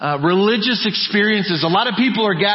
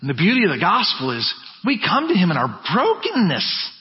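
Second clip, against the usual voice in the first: ordinary talk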